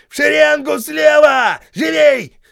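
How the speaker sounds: high, loud bellow; shouting drill sergeant. A narrator's reading. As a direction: bellow at the ranks. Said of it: "завопил Хейксвилл". (вопящий сержант)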